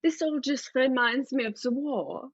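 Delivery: put-on reassuring German-accented mother; gentle German mother. Mother calming her worried daughter, gentle German-accented voice. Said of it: "pats my hand very gently and says".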